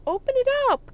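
very high squealed open it up; squealing urge. Retold or imitated. Friend urging her to unwrap the gift, squealing.

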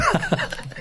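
high burst of laughter; delighted laugh. Real, not acted. Host delighted by quote.

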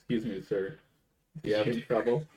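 hushed imitation of a shop worker; mock clerk. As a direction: quietly ask like a wary store clerk. Voicing store clerk.

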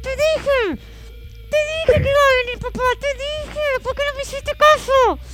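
high, strained mock I-told-you-so; through clenched teeth. Mother reproaching her soaked son, through clenched teeth. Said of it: "Con los dientes apretados".

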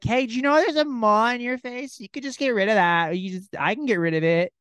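hammed-up woman's voice; mock woman's voice. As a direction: overeager stranger offering help. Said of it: "a woman's like".

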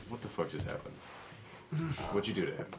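quiet aside. Acted. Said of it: "like, quietly".

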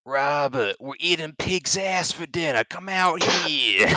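high, drawn-out mom calling out; man doing a woman's voice. Retold or imitated. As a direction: call out like his mom, high and slow. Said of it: "Is that your impression of my mom?".

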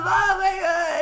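high, loud wailing sob; mock crying child. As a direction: wail it like a child breaking down. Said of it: "he just starts crying".

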